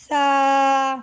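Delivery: سا said thin and gentle; soft sa. Shown as a demonstration. Teacher showing pupils the soft سا. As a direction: give a soft lisped سا. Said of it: "سا نرمی والا حرف ہے".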